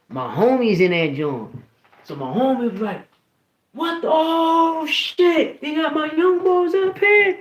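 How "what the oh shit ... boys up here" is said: high, loud, drawn-out holler; stretched-out excited shout, high-pitched; imitated yelling. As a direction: imitate a homie calling out across the yard. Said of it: "they was yelling my name".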